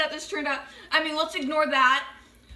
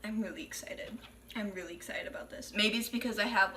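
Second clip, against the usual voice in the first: whispered glee, soft; excited whisper; 4 semitones lower, 10 dB quieter than usual. Real, not acted.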